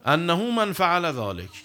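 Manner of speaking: loud, slow Arabic recitation; solemn recitation. Acted. Preacher reciting the Arabic hadith to the congregation, loud and slow. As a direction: intone the hadith slowly.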